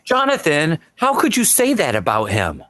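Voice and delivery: high mock-offended protest, Jonathan; mock indignant. Defending dub actors to Jonathan, mock offended. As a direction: play it wounded on the actor's behalf.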